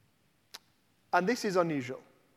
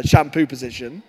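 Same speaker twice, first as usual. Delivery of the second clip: ordinary delivery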